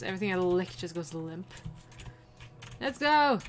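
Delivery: usual speaking voice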